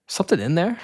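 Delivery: a hint of a child peering in; curious little daughter. Daughter peers into the Elmo costume's mouth, lightly imitated curiosity. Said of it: "she's, like, looking at the mouth".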